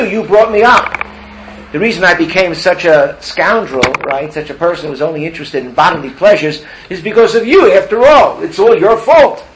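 a son accusing his parents, rising in anger; mounting loud accusation, high-pitched by the end; indignant blaming. Acted.

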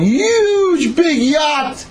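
high, slow, stretched-out excitement; hammed-up wonder. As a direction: build the spectacle, drawing out each word. Storyteller hams up the yacht.

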